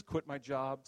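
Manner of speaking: usual speaking voice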